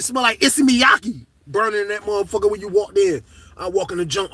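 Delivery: normal talking voice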